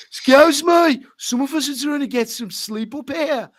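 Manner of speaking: high, loud mock-Brummie complaint; Birmingham accent. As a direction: call out like an irritated Brummie at a window. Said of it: "leans out the window".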